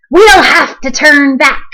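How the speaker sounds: a pirate calling out in the storm; called. A narrator's reading. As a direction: call it across the deck. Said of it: "called another".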